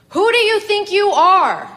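loud, very high, slow furious roar. A narrator's reading. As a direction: roar the final challenge at the sky. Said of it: "and she roared".

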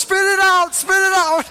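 high-pitched imitated shout; freaking out. Wife ordering husband to spit before onlookers, frantic shouting. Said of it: "she's, like, freaking out".

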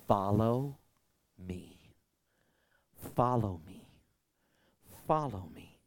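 low, soft, drawn-out invitation; calling gently. Acted. Jesus calling his followers, soft and slow. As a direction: call them softly, with long pauses.

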